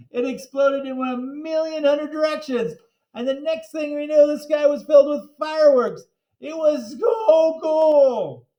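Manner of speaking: high and loud with awe; thrilled. Acted. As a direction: build the wonder up. Narrator thrilled by fireworks.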